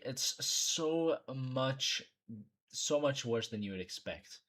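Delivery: ordinary talk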